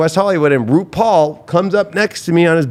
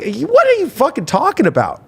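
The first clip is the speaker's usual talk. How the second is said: much higher, disbelieving outburst; incredulous retort; 9 semitones higher than usual. Retold or imitated. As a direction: shoot back in disbelief. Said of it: "I'm like".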